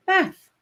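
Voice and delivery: "Meth" said high and sharp, as a realization; meth, surprised. Shown as a demonstration. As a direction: pitch jumps up on "Meth" as it clicks. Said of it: "H, meth".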